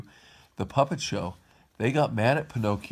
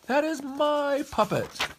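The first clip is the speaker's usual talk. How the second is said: high, drawn-out possessive cry; slower than usual. A narrator's reading. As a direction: stretch it out in a high, indignant story voice. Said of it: "he cried".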